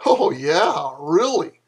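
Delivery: ordinary delivery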